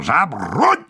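barked military command; sharp command cry, rising at the end; shouted order. A narrator's reading. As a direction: call the troops to arms. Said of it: "krzyknął".

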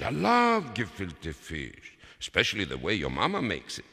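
stretched, relishing delight; high, emphatic enthusiasm; gushing father. A narrator's reading. Father gushes about the dish.

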